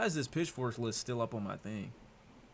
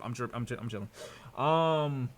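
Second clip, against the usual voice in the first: ordinary talk, nothing marked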